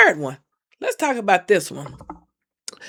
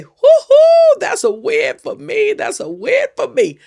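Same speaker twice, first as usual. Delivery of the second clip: a high whooping cheer, then a bright victory call; excited cheer; 12 semitones higher, 6 dB louder than usual. Pretend. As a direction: celebrate hanging up on the scammer. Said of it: "want you to say".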